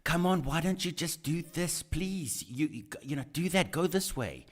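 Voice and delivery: mock urging at a TV character. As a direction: egg the character on from the couch. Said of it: "you're saying".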